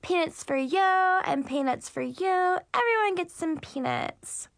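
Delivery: high, slow singsong hostess voice; overly cheerful airline hostess; mock flight attendant. Mocking rival as flight attendant.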